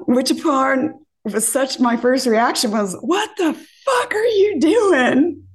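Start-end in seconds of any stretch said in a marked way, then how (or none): 3.0-5.4 high, slow, laughing disbelief; shocked outburst. Retold or imitated. To the man who slapped her, shocked laughing yell. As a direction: squeal it out in stunned disbelief.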